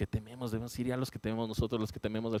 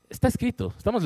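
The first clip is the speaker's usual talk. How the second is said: preaching emphasis; 12 semitones higher, 6 dB louder than usual. Acted.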